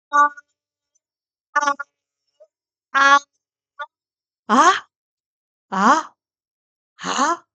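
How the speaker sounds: 아 sung through level, rising, dipping and falling tones; four tones review. Shown as a demonstration. Teacher reviewing all four Mandarin tones on 아. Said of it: "성조 한번 다시 살펴보고".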